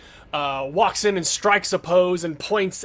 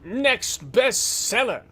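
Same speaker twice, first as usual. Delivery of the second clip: pompous hero shout; 9 semitones higher, 8 dB louder than usual. Acted. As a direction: announce it to the crowd like a vain hero. GM voices vain detective.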